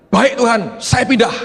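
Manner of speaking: high, loud, excited acceptance; eager loud yes. Retold or imitated. Preacher telling God he will move, excited and loud. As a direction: leap at it with a joyful shout. Eager answer to God's call.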